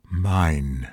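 ordinary delivery

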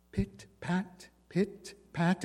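pit, pat said soft and hushed, far off; hushed pit, pat like steps heard through walls; soft distant footsteps. A narrator's reading. Narrator voices the dog's steps on the landing, softly. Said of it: "It was on the second landing".

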